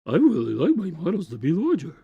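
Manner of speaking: cartoonish send-up of a colleague; Chris. Co-host demonstrates his voice for his colleague, insulting impression. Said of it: "I made that my Chris voice".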